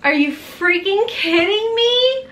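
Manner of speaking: can't believe your luck; thrilled disbelief; stunned delight. Real, not acted. Stunned by a big box.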